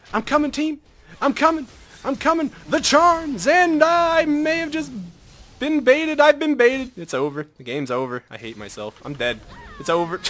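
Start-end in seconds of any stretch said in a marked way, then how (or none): 0.1-7.0 higher, louder call to the team; eager yell turning to dismay; excited calling out. Real, not acted. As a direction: shout it while rushing in. Rushing to help teammates.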